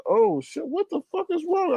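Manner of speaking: inner shock. Retold or imitated. Retelling own reaction to the chat, high hushed shock. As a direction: gasp it quietly, high and thrown. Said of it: "And I'm like".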